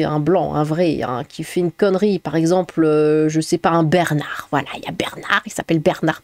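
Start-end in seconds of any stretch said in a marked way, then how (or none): 3.7-6.2 breathy stage whisper, lower; whispered aside as if sharing a secret. Acted. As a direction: drop to a conspiratorial whisper.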